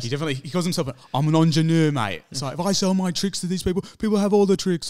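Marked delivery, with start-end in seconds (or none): 1.1-2.2 loud, hammed-up Cockney impression; higher, louder put-on British film-star voice; Michael Caine. 2.6-4.9 high, loud, dreadful Cockney impression; badly imitated actor's voice, pitched up; Michael Caine.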